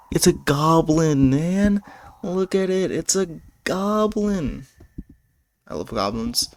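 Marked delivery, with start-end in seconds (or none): 0.1-4.7 higher, thrilled goblin fan; excited. Real, not acted. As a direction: gush over the goblin.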